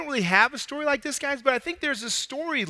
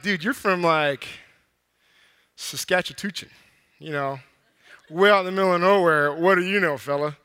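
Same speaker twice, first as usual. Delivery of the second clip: mocking city slicker; 5 semitones lower than usual. Someone mocking a man from nowhere, sneering put-down. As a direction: look down on the country boy.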